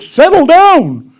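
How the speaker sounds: alarmed student. Retold or imitated. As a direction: beg him to calm down, drawn out. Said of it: "He said".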